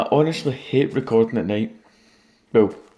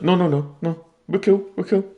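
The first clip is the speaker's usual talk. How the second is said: high, louder pleading; nervous creeper voice; 8 semitones higher, 4 dB louder than usual. Retold or imitated. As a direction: back down fast and high. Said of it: "The creeper's just like".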